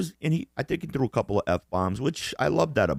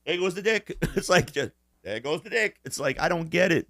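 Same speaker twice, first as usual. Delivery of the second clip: laughing mockery; 9 semitones higher than usual. Real, not acted. Laughing mock of Biden.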